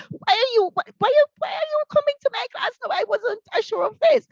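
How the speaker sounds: high-pitched defensive protest, acted out. Teacher acting out a teacher caught by a surprise appraisal, defensive. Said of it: "get defensive".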